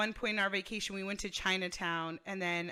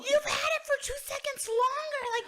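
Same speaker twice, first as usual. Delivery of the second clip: put-on high, loud kid's protest; hammed-up childish outcry over unfair sharing; squabbling kid; 6 dB louder than usual. Cousins fighting over one drink, mock outraged kid.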